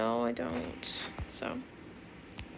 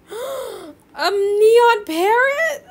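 very slow, high, loud astonished cry; gasp, then shout it out in awe; 9 semitones higher, 10 dB louder, much slower than usual. Real, not acted. Shocked by a neon parrot offer, slow loud high gasp.

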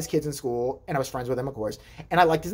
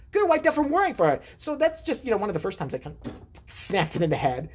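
ordinary delivery

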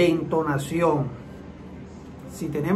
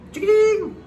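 high-pitched starter-motor squeal; imitated starter crank; 14 semitones higher than usual. Shown as a demonstration. Mechanic showing repeated tries to start a car, imitated starter noise.

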